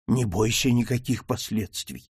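usual speaking voice

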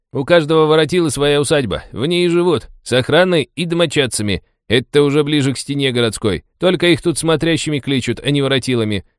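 high-pitched driver voice, explaining; chatty taxi driver, informative. Acted. Taxi driver explains the local bosses to passengers, chatty and high.

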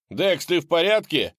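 high, loud worried call. Acted. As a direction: call out to him in alarm. Calling to injured friend.

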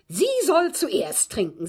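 lightly voiced Catty insisting the maid drink first; Catty's demand. Maid quoting Catty insisting she drink first, mildly sharp. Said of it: "sagte sie".

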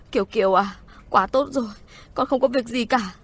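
acted crying with relief over a child; tearful relief. A narrator's reading. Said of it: "khóc òa thành tiếng".

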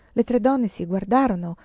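usual speaking voice

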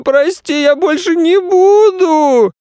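very high, drawn-out tearful wail; bawling. A narrator's reading. Boy begging mum's forgiveness from space, bawling. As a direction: wail it out as a crying child. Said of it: "заорал на всю пустоту".

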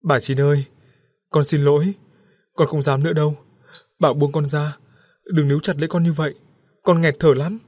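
high, loud frantic pleading; babbling in terror. A narrator's reading. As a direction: beg the ghost to let go. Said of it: "cười khóc huyên thuyên". (người chồng loạn trí van xin hoảng loạn)

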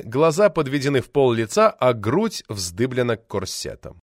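plain reading voice

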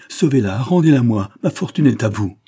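crying out. A narrator's reading. Husband begging surgeon to save his wife, crying out. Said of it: "s'écria celui-ci".

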